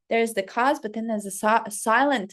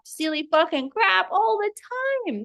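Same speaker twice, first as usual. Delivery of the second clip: ordinary delivery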